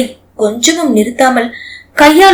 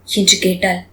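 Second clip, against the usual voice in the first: ordinary delivery, nothing marked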